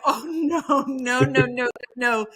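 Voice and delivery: half-joking dread at seeing a rival; mock panic. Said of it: "And I was like".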